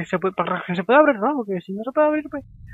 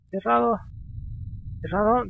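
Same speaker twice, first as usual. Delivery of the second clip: ordinary talk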